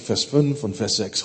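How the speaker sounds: ordinary talk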